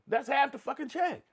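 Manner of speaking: high, loud indignant outburst; hammed-up disbelief at the payout; mock outrage.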